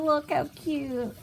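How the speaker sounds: ordinary delivery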